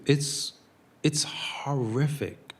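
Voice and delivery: slow, whispered verdict; hushed. Real, not acted. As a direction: let the words fall to a slow whisper. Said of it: "speaker's own hushed verdict".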